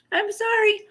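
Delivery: sheepish, high little "sorry"; small apologetic squeak. Real, not acted. Repeats apology in tiny high voice.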